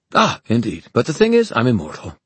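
breezily unbothered by the threat; cheerful. Acted. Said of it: "Magnus said cheerfully".

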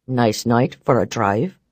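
low, dry and cool; low dry man voice. Acted. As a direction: toss it off, unimpressed. Barrons replies drily.

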